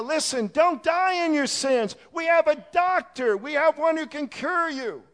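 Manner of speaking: high, full-force shout; urgent preaching yell. Acted. Preacher warns sinners from the bridge, urgent full-force yell. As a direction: yell a desperate warning from the pulpit. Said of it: "get on the bridge and yell".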